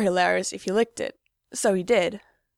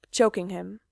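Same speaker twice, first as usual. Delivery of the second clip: usual speaking voice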